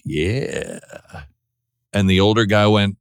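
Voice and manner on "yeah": low, soft, drawn-out yeah; mock ogling. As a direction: ooze a slow, approving yeah under your breath. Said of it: "supposed to turn and look at him like".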